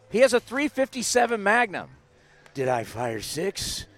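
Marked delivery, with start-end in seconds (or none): 2.5-3.8 low, slow gravelly-free Dirty Harry voice; lightly voiced Dirty Harry, slow; Clint Eastwood impression. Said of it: "since I have a cold, I can do it".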